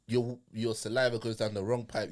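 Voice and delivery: ordinary talk, nothing marked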